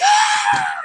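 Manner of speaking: loud, very high scream; full-out acted shriek of fright. Storyteller as brother pops his head in, screaming. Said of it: "I screamed".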